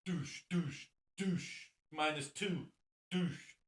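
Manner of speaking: "Douche" said high, as a fake French "deux"; mock French deuce. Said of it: "Numero deuce".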